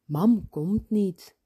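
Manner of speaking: plain reading voice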